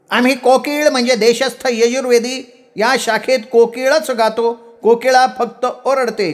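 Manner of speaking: high-pitched indignant lecture; irritated protest. A narrator's reading. Doctor correcting them on who sings, irritated cry. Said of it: "एकदम चिडून ओरडले".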